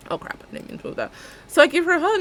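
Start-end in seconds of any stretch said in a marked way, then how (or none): 0.1-1.1 soft, fast, unvoiced; whispered aside. Real, not acted. Vlogger noticing she moved something, whispered. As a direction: mutter it under your breath. Aside mishap while filming.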